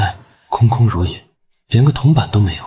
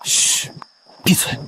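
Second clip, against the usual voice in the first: hushed, higher-pitched command; urgent shushing; 7 semitones higher, 4 dB quieter, slower than usual. Acted. Prince silences the apologizing driver, hushed. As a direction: shush the servant without waking the sleeper. Hushes servant beside sleeper.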